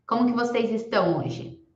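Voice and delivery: normal talking voice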